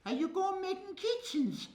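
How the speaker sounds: put-on high childlike question; child's voice. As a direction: ask it like a small boy. Voicing a child asking father.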